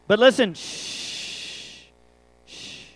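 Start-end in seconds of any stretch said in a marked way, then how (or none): 0.5-1.8 long drawn-out shh; long hush. Real, not acted. Preacher settles the youth crowd after a gross joke, long hush. Hushing the audience mid-sermon. 2.5-2.9 soft, brief shh; a quieter follow-up hush; short hush. Real, not acted. Preacher settles the youth crowd, short soft hush. Hushing the audience mid-sermon.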